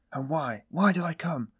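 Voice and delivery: rising, anguished questions; despairing self-reproach. A narrator's reading. Despairing self-reproach after he cried.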